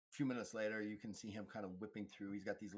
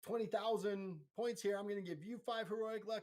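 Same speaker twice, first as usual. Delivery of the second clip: high-pitched, building to a loud laughing shout; Oprah-style giveaway; 12 semitones higher, 7 dB louder than usual. Retold or imitated. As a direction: hype up the studio audience with prizes. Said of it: "felt like we were on Oprah".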